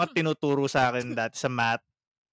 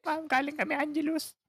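much higher squeaky student excuse; mimicking students; 13 semitones higher, 4 dB quieter than usual. Teacher's students making an excuse, high mock squeak. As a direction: pitch it way up like a sheepish kid.

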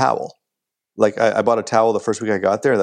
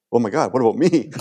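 mock self-centred wail; hammed-up dismay, on the edge of laughing; selfish panic; 3 semitones higher than usual. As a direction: play up your own selfish alarm. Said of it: "no, I was selfish".

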